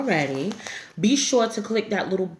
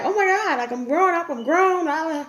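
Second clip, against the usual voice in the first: higher, giddy voice playing her younger self; mock grown-up girl; 9 semitones higher than usual. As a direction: act like a kid who thinks she's grown.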